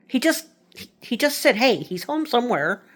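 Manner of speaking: high, insistent mock teen whine; a daughter protesting she heard him; confused daughter. Daughter insisting to her mother she heard stepdad.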